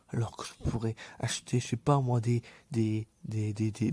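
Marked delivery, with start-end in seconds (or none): none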